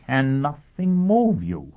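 high, slow scornful question; contemptuous disbelief. Acted. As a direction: sneer it out slowly. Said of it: "asked Pristine scornfully".

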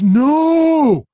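high loud desperate no; shouting over engine. Acted. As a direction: scream it out. Jimmy yelling at bus.

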